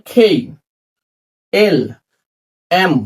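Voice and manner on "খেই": "খেই" with a clear puff after the k; aspirated K. Shown as a demonstration. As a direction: breathe out on the k of "খেই", gliding to i. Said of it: "কে উচ্চারণ হবে". (বাতাস ছেড়ে "খেই")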